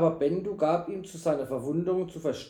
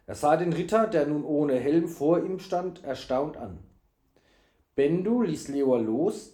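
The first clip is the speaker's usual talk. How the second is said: ordinary delivery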